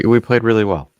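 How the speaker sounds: loud fake praise; sarcastic. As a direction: say it with heavy irony. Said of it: "I was being sarcastic".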